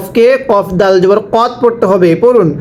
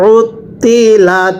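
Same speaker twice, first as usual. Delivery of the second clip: normal talking voice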